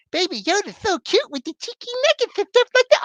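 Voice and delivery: put-on high, cooing baby-talk voice; cutesy gushing. Reviewer coos over Baby Yoda in a high cutesy voice.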